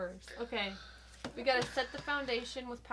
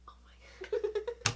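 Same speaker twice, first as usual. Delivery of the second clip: laughing; 9 semitones higher, slower than usual. Real, not acted. Seeing herself, breathy laugh.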